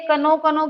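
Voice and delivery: repeated "कनो" with the k sounded; imitating student's mispronunciation. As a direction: echo the mistaken k in "कनो". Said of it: "क्या बोल रहा है".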